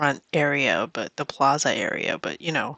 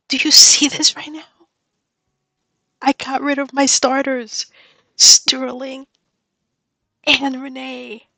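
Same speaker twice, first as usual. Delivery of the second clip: much higher and louder, elated; giddy disbelief; 8 semitones higher, 5 dB louder than usual. Real, not acted. Gamer telling Rita her starters are gone, giddy. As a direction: gush to your friend in glee.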